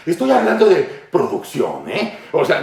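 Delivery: ordinary delivery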